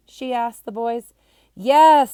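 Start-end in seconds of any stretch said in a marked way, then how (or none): 1.5-2.1 high, loud unison shout; shouted yes. A narrator's reading. Class answers the storyteller in a loud shouted yes. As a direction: bellow it like a bored class. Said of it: "the class all shouted out in unison".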